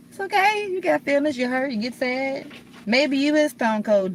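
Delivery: reassuring. Real, not acted. Telling viewers it's okay to hurt, high and loud. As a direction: lift them up with reassurance.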